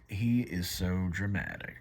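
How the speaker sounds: low, soft, deadpan aside; unimpressed angels. Acted. Angels remark on God's outburst, unimpressed. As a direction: drop the voice, quietly judging. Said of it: "his angels are like".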